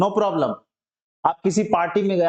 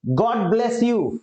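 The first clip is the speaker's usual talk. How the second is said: slow model phrase; 5 semitones higher, much slower than usual. Shown as a demonstration. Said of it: "teacher models new phrase".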